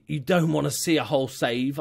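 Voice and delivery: ordinary delivery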